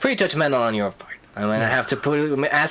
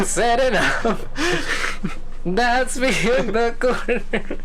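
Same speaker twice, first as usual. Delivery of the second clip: laughing high and slow; 9 semitones higher, slower than usual. Pretend. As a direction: giggle the lyric out. Singing [name] while laughing.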